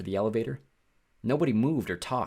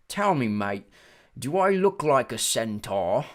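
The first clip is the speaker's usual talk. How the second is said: slow drawn-out British character voice; British-like accent; 3 semitones higher, 5 dB louder, slower than usual. A narrator's reading. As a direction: put on an odd, foreign-tinged British accent. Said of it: "He had a strange accent. British maybe".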